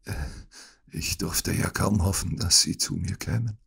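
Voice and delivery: soft sickbed voice; feeble whisper. A narrator's reading. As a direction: speak weakly to your visitor. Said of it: "flüsterte Holmes". (leise, krankes Flüstern)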